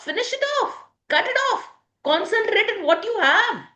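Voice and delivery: high-pitched, insistent commands; emphatic urging. Shown as a demonstration. Coach telling listeners to cut off losses, emphatic urging. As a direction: urge them firmly, pitched well up.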